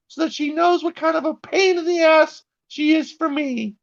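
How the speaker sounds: high falsetto Karen mom; whiny entitled mom in falsetto; Karen mother voice. Retold or imitated. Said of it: "Karen mother writes to Elon Musk and she's like".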